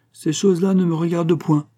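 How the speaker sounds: high, loud, scoffing brush-off; amused dismissal. A narrator's reading. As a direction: wave it away as none of your business. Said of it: "se mit à rire". (désinvolture moqueuse)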